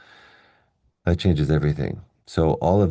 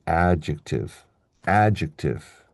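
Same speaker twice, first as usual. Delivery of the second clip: "adjective" with the opening syllable strong and the rest reduced; first-syllable stress. Shown as a demonstration. Teacher shows word stress, "adjective" stressed on the first syllable. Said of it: "the stress is on the first syllable".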